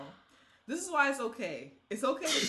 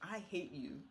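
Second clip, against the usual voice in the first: ordinary talk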